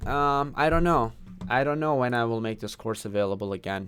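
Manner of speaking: usual speaking voice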